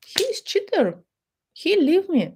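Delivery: high, mock-wounded complaint; whiny wife. Abandoned wife complaining, high mock-wounded whine. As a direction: wail it high like a wronged wife.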